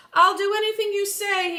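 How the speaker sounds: high, loud begging. Acted. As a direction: beg on your knees, pitched high. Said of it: "he begged".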